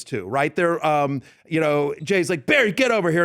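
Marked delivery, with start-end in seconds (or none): 2.5-3.2 higher-pitched summons; mock yell calling someone over. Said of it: "And so they're yelling".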